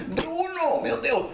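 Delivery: high, panicky mock cry; alarmed calling. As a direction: call out to a drowning child, acted. Uncle calls to drowning nephew. (grito aflito encenado, bem agudo)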